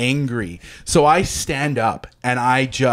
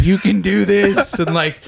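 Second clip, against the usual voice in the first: cheering on; 6 semitones higher than usual. Retold or imitated. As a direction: cheer them on in a raised, singsong pitch. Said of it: "cheering me on".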